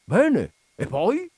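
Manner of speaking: high-pitched, eager prompting; keen interest. A narrator's reading. Coachman gripped by don Michele's tale, excitedly.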